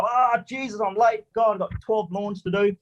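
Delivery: high, frantic mock panic on waking late; panicked oversleeper. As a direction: play the man who just realised he overslept. Waking late in retold story.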